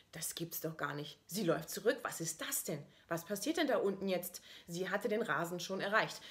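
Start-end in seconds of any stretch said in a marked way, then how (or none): none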